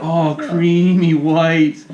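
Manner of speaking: very slow, exaggerated fake German accent; bad German accent. Joking as Hitler in a bad German accent. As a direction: drag it out in a hammed-up German accent. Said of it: "terrible German Hitler accent".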